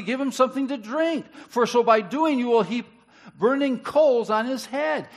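loud, high impassioned scripture reading; preaching. Acted. Preacher proclaiming Romans to his church, loud and impassioned. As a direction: thunder the verses out to the congregation.

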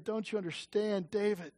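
soft, tender voice of God; God comforting David. Retold or imitated. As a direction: reassure him gently as a loving father. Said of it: "God says of David".

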